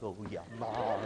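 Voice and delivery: a lightly voiced, low, blissful dog; contented dog. Voicing a dog getting its one spot scratched, low and blissful. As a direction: sigh it out like a happy dog. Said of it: "where they're like".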